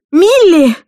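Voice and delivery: full, high scream of her name; desperate shout. Acted. Bride screaming as her sister tumbles down, desperate shout. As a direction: cry out in panic as she falls. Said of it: "отчаянно крикнула я".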